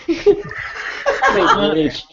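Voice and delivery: mock insult cracking up mid-laugh. Real, not acted. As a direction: toss it off while laughing.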